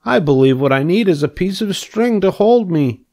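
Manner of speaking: high-pitched pig reasoning; cheerful pig. A narrator's reading. Pig plans to use string, cheerful. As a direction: play a hopeful little pig with a plan.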